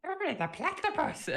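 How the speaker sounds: very high, loud cartoon-villain accent; Doofenshmirtz accent. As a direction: shriek it in a throat-straining character voice. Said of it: "Do you like the Doofenshmirtz accent?".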